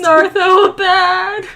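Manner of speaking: high, loud, drawn-out wail; mock sobbing. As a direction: wail slowly as if sobbing into hands. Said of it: "like, sobbing in his hands".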